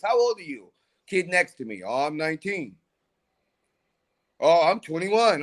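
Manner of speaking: ordinary talk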